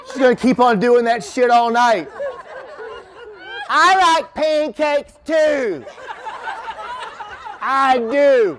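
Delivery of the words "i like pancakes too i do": high, stretched-out shouted mimicry; mock audience caller. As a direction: call it out slowly and high, like an oblivious heckler.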